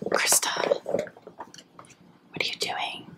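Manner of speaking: slow, hushed whisper; playful whispered scold to a friend. Pretend. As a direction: whisper it like a fond tease.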